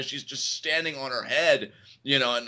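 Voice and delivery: ordinary talk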